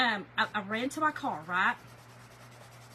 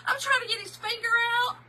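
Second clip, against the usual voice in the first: high, tearful voice breaking into a cry; real tears pushing the words up high; crying while telling it; 9 semitones higher, faster than usual. Real, not acted. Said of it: "I just start crying".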